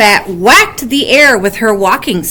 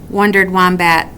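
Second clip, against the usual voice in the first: usual speaking voice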